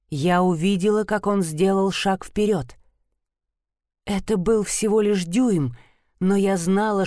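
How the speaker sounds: ordinary delivery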